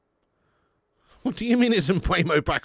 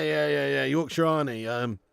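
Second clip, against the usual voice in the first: normal talking voice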